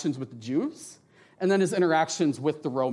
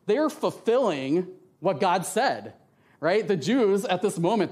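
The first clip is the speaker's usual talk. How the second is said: ordinary talk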